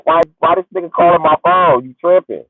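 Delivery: normal talking voice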